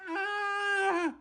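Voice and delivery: dainty high gasp; tiny high cry. Shown as a demonstration. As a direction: squeak "Ah!" up high and small.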